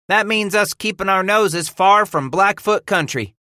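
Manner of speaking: high, loud, different voice; eager young trapper. Acted. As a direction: chime in eagerly. Hooks chimes in.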